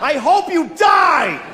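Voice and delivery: high, slow mock curse; mock shout at another driver; mock yell. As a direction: spit it out like road rage. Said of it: "I said that to a person".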